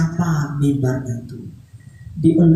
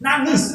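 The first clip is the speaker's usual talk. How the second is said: "Nangis" said high and stressed to convince; insisting; 9 semitones higher than usual. Shown as a demonstration. Storyteller insisting to doubtful children: high stressed "Nangis". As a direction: hit "Nangis" high and hard. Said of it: "gak percaya".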